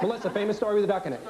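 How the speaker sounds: rapid-fire classroom prompt; breakneck pace. Shown as a demonstration. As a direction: hurry the pupil with a fast question. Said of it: "moving at this breakneck speed".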